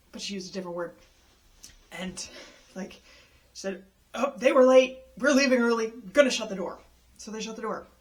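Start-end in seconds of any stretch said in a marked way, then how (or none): 4.1-6.8 loud, mocking mimic of the attendant; hammed-up scornful attendant impression; mocking dismissive. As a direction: throw her excuse back scornfully, louder.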